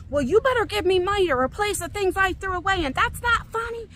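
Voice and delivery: high-pitched indignant scolding; retold yelling. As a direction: tell off the prankster, voice climbing. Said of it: "I'm arguing and yelling at him".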